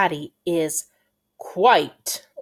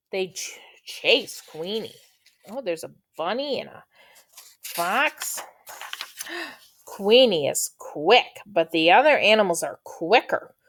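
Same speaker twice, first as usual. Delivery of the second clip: plain reading voice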